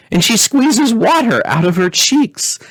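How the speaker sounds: put-on high, teasing child voice; giggly little princess. Princess teasing her weeping mother, in a high giggly voice. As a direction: poke fun at Mama like a giggling girl.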